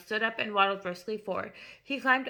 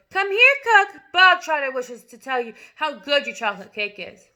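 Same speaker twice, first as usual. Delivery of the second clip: much higher, very loud yell; shout; 5 semitones higher, 11 dB louder than usual. A narrator's reading. Headmistress yelling for the cook across the hall. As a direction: yell it at the door.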